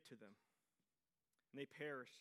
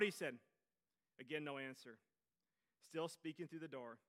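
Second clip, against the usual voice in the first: ordinary talk, nothing marked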